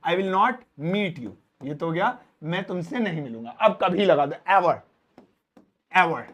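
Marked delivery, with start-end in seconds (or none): 0.1-1.3 "I will not meet you", "not" slow and clear; slow careful teacher read; slow model sentence. Shown as a demonstration. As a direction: dictate it slowly. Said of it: "translation demo".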